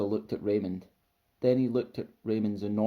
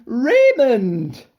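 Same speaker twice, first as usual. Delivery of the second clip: huge, high drawn-out call; loud shout; 13 semitones higher, 14 dB louder than usual. A narrator's reading. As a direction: bellow the name across the distance. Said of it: "shouted out loudly".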